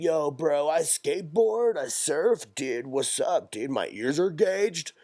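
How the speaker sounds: surfer bro voice. Retold or imitated. Dad's friend Bree bragging about skating and gauged ears, surfer bro voice. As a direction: play the chill surfer bragging. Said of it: "he was like".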